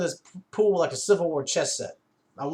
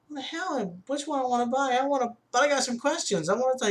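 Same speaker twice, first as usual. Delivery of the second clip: higher, retold disbelief at a silly question; incredulous outburst; 10 semitones higher than usual. Shopper retelling his disbelief at the salesman, pitched-up incredulity.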